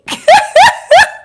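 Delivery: high burst of laughter. Real, not acted. Laughing at her own bleaching joke, high laugh. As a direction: cackle up high.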